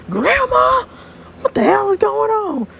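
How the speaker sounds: very high, stretched-out alarm; high-pitched panicked confusion; shocked cry. Acted. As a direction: wail in bewilderment. Shock on waking in present.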